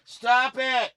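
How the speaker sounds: high urgent yelp; high startled yelp. Real, not acted. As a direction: yelp it at the noise. Guitar noise won't stop.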